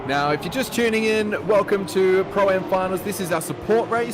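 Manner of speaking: high, bright broadcast welcome; announcer voice. Acted. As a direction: pump it up like a live host. Announcer welcomes viewers tuning in.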